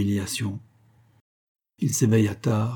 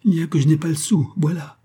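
higher-pitched, despairing admission; desperate confession; 6 semitones higher, 3 dB louder than usual. A narrator's reading. Man confessing to avoid a break-up, desperate outburst. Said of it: "il déclara avec un accent désespéré".

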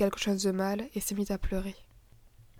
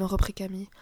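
ordinary delivery

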